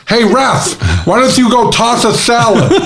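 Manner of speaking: put-on high little kid voice with a lisp. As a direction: imitate a four-year-old with a lisp. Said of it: "he had a, a lisp, so it sounded like this".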